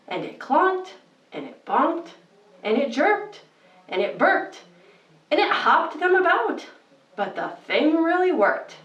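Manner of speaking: put-on lilting read-aloud rhythm for children; bedtime story reading. Host reading Seuss to kids at bedtime, lilting storybook voice. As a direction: read it like a cozy bedtime book.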